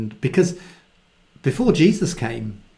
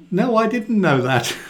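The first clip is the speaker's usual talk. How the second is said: exasperated mother; 7 semitones higher than usual. Retold or imitated. Mary's retort, voiced.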